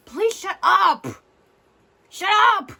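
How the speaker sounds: loud, high-pitched angry shout; exasperated yell. Real, not acted. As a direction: yell it at them, really fed up. Telling the hosts to shut up.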